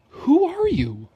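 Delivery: mock the author with feigned wonder; mock disbelief. Sarcastic reaction to author.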